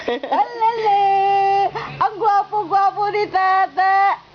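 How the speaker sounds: put-on high, slow, drawn-out baby talk. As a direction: stretch it out, cooing. Grandpa coos to baby.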